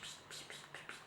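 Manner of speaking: whispery little spritz sounds; soft, voiceless "pshit-pshit" of a spray bottle; imitated spray noise. Shown as a demonstration. Showing viewers the little spray bottle, whispered spritz noises.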